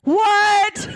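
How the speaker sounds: high-pitched, laughing exclamation; shocked outcry. Real, not acted. Hearing about the Hat Man sighting, shocked outcry. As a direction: cry out in laughing disbelief.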